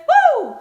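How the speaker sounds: high loud singing woo; a soaring falsetto cry in the song; sung falsetto woo. Pretend. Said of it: "had to hear Purple Rain".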